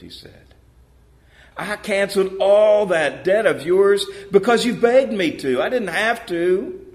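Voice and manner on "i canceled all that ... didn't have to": higher, louder scolding master; the master scolds his ungrateful servant; angry rebuke. A narrator's reading.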